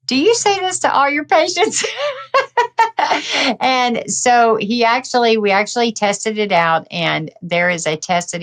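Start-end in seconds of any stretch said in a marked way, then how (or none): 0.0-1.8 high, laughing comeback; laughing retort. Retold or imitated. Patient laughing back at her doctor. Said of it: "And I'm like". 1.9-3.5 high, long real laughter. Real, not acted. Guest laughing at her own doctor story. After quoting her retort.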